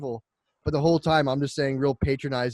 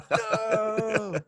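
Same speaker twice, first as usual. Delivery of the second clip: despairing no; 9 semitones higher than usual. Retold or imitated. Despairing cry as brother leaves.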